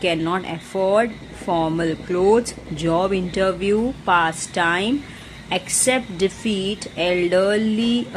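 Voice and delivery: each phrase read out slowly and separately; slow dictation. Shown as a demonstration. Teacher reading vocabulary slowly to the class. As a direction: dictate the list word by word. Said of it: "वापस से repeat कर रही हूँ मैं".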